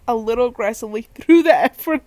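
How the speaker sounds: breaking into laughter mid-sentence; words giving way to giggles; real laughing. Real, not acted. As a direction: lose it as you reach the punchline. Cracks up at own punchline.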